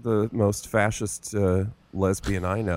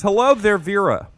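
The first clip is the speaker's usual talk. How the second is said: campy greeting; 9 semitones higher, 4 dB louder than usual. Acted. Host greets guest theatrically.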